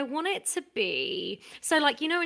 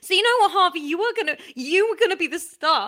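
hammed-up tirade, high and loud; mock outrage; 5 semitones higher, 6 dB louder than usual. Creator tells Harvey they've lost the starring role, mock outraged. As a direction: build into a loud, aggrieved rant. Mock quarrel over pitched show.

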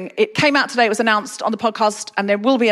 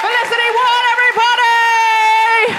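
announcer intro; 13 semitones higher, slower than usual. Real, not acted. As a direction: present the act to the crowd. Host introducing comedian.

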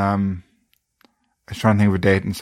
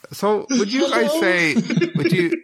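mock coy wheedling; 10 semitones higher than usual. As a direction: pitch up and drag it out to fish for praise. Fishing for praise, mock.

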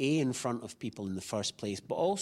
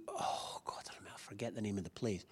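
breathy whisper while trying to recall a name; whispered aside; 8 dB quieter than usual. Real, not acted. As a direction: drop to a private whisper, half to yourself. Forgetful aside about the restaurant.

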